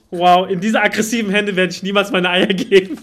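loudly refuse, hamming it up; mock indignant. (laut, gespielt empört)

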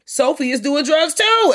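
high, loud and slow, outraged; indignant exclamation. Real, not acted. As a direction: spell it out in disbelief.

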